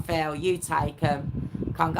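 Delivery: ordinary talk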